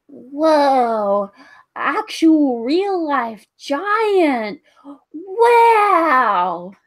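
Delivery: put-on high, slow, wide-eyed child voice; excited kid. Kid marvelling at the giant, high and amazed. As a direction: gasp it out like an awestruck little kid.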